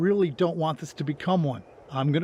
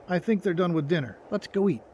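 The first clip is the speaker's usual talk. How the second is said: ordinary talk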